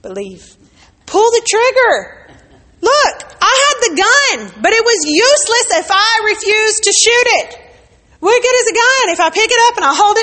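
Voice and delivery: loud, high, driving sermon voice; preaching at full force. Acted. Preacher rousing the church about her weapons, loud and driving.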